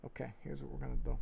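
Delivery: ordinary talk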